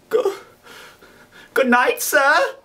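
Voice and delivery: put-on high, halting, frightened boy; faltering. Boy takes leave of the convict, faltering and frightened. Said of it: "I faltered".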